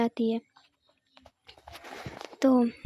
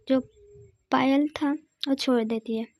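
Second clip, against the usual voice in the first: ordinary delivery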